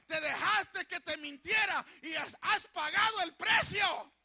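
rally the flock, building to a shout; fervent preaching. Real, not acted. Pastor urging the church to resist the devil, loud and high.

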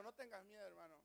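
soft, intimate reassurance; quiet comfort for a neighbour in the pew; softly to your brother. Shown as a demonstration. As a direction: say it gently to the person beside you. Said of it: "Dile a tu hermano".

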